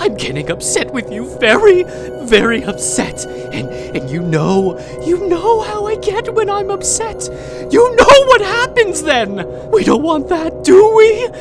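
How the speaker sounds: high, loud, tearful threats; crying as the warnings build toward a threat; rising menace. Acted. Caretaker warning her captive pet what happens when she's upset, tearful menace.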